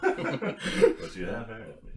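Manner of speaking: slow, laughing British pirate drawl; jokey British movie quote, nearly voiceless with laughter; Johnny Depp pirate. Acted. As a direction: imitate the British-accented pirate, slowly. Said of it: "Johnny Depp's role in Pirates of the Caribbean".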